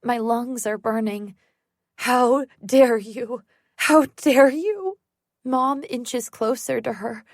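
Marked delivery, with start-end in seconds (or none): 2.1-3.4 slow, loud seething fury; rage. Acted. Mother confronting her daughter's attacker, slow loud rage. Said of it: "her face contorted in rage". 3.8-5.0 slow, higher, louder furious cry; rising rage. Acted. Mother confronting her daughter's attacker, higher louder fury. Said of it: "her face contorted in rage".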